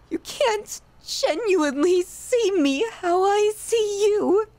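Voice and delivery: pleading through tears to someone who fears them; tearful, breaking. Acted. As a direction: let the voice break on the last words. Lamenting being feared.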